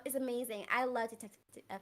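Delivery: normal talking voice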